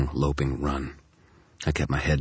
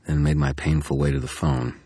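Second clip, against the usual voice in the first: usual speaking voice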